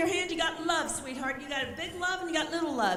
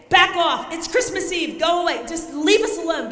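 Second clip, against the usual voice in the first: loud, higher, firm warning; forceful command; 5 semitones higher, 6 dB louder than usual. Shown as a demonstration. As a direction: tell him to leave, with force. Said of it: "you're gonna step back and say".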